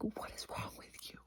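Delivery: unvoiced exasperated whisper; whispered disbelief. Whispering her frustration at the boy's behaviour. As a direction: breathe it out in disbelief. Whispered retort about boy.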